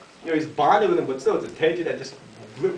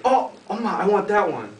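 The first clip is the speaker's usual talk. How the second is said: put-on higher, eager child's plea; excited boy; 6 semitones higher, 4 dB louder than usual. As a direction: blurt it out with delight, acting the boy. Boy spots the piglet he wants.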